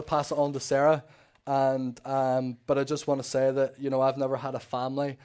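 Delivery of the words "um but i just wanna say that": ordinary talk, nothing marked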